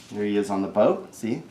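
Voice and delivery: ordinary delivery, nothing marked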